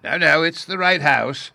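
high, loud, cheery correction; reply brightly as a cheerful old professor. A narrator's reading. Professor corrects Bruno at the door, cheerfully. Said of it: "the professor cheerfully replied".

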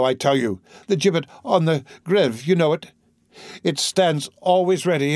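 impassioned, tormented priest pleading; a priest begging in torment; anguished priest. Acted. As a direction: pour out a desperate forbidden love. Priest's anguished plea to girl.

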